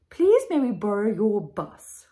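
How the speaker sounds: loud, posh royal request; grand queen. A narrator's reading. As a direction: ask with regal pomp. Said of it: "she said".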